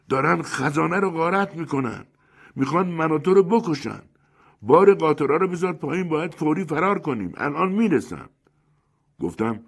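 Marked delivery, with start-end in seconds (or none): 0.1-8.3 high, urgent alarmed shout. A narrator's reading. Great Yakuma warning of a raid on the treasury, shouting. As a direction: cry out a warning, pitched well up. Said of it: "داد زد".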